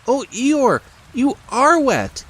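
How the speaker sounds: small high squeaky piglet voice. A narrator's reading. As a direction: play a tiny timid piglet, high up.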